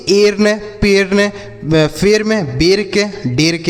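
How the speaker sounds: "एर्न, पेर्न, फेर्न, बेर्क, डेर्क" with ay before r; wrong E vowel. Shown as a demonstration. Teacher warning children off misreadings, "बेर्क, डेर्क" with ay vowel. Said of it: "इस तरीके से नहीं पढ़ेंगे".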